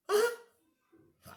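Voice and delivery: normal talking voice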